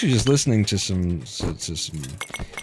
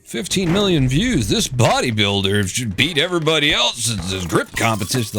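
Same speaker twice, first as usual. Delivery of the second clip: higher, hyped-up clickbait title read; mock clickbait hype; 5 semitones higher than usual. Gamer mocking clickbait titles, mock hype. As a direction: oversell it like a sensational video title.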